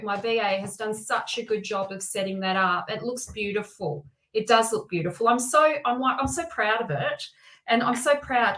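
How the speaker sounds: normal talking voice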